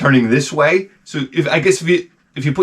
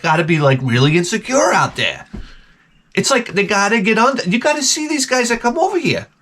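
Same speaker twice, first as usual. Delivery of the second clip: ordinary talk, nothing marked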